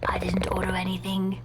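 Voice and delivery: low unvoiced whisper. Customer answers the pizza guy in a whisper. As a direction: breathe it out in a hushed voice.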